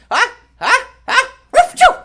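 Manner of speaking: high, loud building ah-ah-choo; pretend doggy sneeze. Little dog in the song sneezing, high and loud. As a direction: fake a big puppy sneeze. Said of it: "The little ones stop to sneeze".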